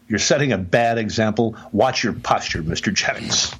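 usual speaking voice

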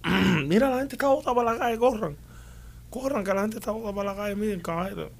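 high-pitched retold yell, not full volume; imitando: mock yell. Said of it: "le empecé a gritar a esta gente". (grito de alerta recreado, en tono agudo)

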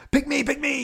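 squeaky high pleading; eager pick me. Retold or imitated. Woman desperate to be picked, squeaky high. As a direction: beg to be chosen, way up high.

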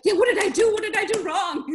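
mock panic, high and loud; imitated fluster, pitched well up; alarmed customer. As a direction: blurt it out like a worried customer. Acts out customer alarmed about insurance.

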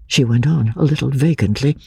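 plain reading voice

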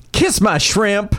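high, drawn-out catchphrase delivery; master thespian reading. Acted. Actor reads the second quote for contestants, theatrically. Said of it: "Let's go to Master Thespian".

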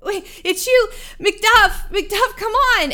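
high, loud desperate call to Macduff; yelling out. Acted. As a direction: shout to get through to someone you know. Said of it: "you hear him yell out".

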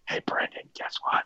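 unvoiced stage whisper. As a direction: whisper it like a secret. Host whispers a joke aside.

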